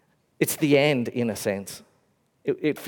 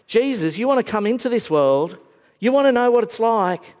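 high, loud pleading address; urgent plea; 8 semitones higher, 4 dB louder than usual. Retold or imitated. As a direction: press Jesus to come. Inviting Jesus passionately.

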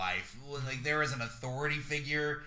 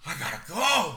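mock fleeing convict; 5 semitones higher, 8 dB louder than usual. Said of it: "and you're just like".

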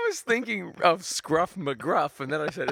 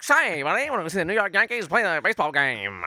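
put-on old-timey American; 4 semitones higher, 4 dB louder, faster than usual. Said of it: "Is that how they talked?".

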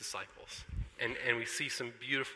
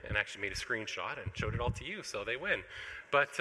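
ordinary talk

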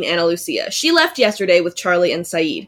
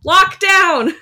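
mock battle cry; 10 semitones higher, 3 dB louder than usual. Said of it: "people are like, "Man down!".

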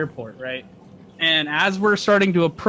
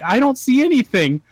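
high, raised-voice retort; exclaimed out loud; 9 semitones higher, 4 dB louder than usual. Retold or imitated. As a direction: blurt it out high, reenacting a baffled reply. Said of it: "I said that out loud".

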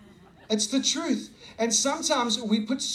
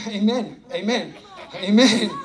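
laughing amen; 6 dB louder than usual. Real, not acted. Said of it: "laughing amen".

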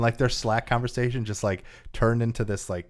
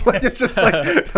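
high, slow laughing words; laughing through the words; 12 semitones higher, 9 dB louder, slower than usual. Real, not acted. Laughing about ostrich game.

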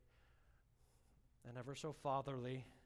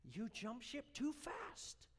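higher, slower repeat; slow preacher's emphasis; higher, slow emphatic repeat; 10 semitones higher, much slower than usual. Shown as a demonstration. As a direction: lean hard on the repeat. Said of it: "repeated for emphasis".

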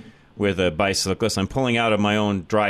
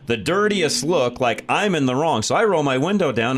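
ordinary delivery

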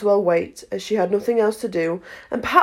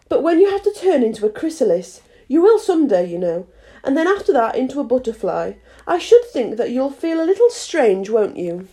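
put-on higher-pitched storybook girl; earnest polite Alice; 4 semitones higher than usual. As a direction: reason politely as a young heroine.

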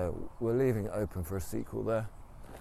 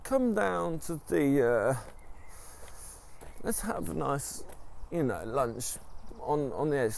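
wife's voice; 5 semitones higher than usual. Retold or imitated. Wife lures husband to lunch, high sweet voice. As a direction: coax him sweetly, pitched up.

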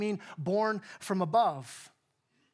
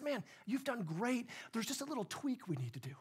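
a soft, indulgent reassurance; mock gentle pat on the back; 3 semitones higher, 9 dB quieter than usual. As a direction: ease him along kindly, softly. The gentle version Jesus doesn't say.

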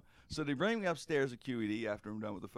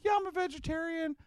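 mock Robert; 3 dB louder than usual. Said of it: "he says".

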